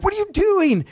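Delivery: high-pitched, incredulous mock scolding; squealed reproach, played for laughs; retold scolding. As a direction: imitate a parent scolding, pitch shooting way up. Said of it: "I kinda scolded him for it".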